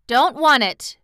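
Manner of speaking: put-on a boy's quick, emphatic no; prompt refusal. A narrator's reading. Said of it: "said the boy promptly".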